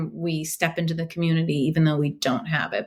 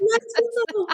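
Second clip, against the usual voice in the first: excited cheer. Retold or imitated. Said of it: "And I'm like".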